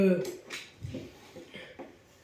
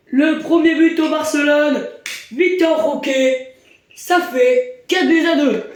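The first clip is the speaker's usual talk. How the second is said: excited sports commentary; 4 semitones higher than usual. Acted. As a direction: call the goal with energy. Goal call commentary.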